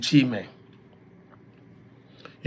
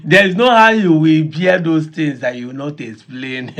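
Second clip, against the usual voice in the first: ordinary talk, nothing marked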